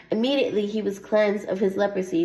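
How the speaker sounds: ordinary talk, nothing marked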